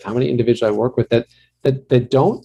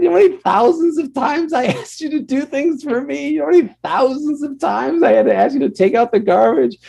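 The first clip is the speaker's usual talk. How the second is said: high-pitched mock father voice, laughing at her; imitating her father. Father laughing at his daughter, mocking high voice. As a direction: lampoon dad teasing his grown child, pitched way up. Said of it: "He said".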